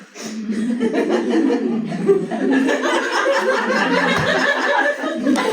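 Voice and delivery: a high, real laugh bursting out; high burst of laughter. Real, not acted. Speaker laughs at comparing the quote to her O R, high and real. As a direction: laugh freely at your own joke.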